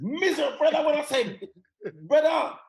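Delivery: high, loud grumbling complaint; miserable dad impression.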